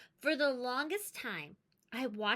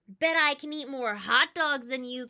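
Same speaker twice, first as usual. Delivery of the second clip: teasing; 3 semitones higher, 8 dB louder than usual. Acted. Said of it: "he teased".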